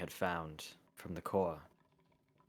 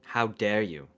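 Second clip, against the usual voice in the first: indignant outburst; 3 semitones higher, 9 dB louder, slower than usual. Acted. Kieran confronts the Big Boss.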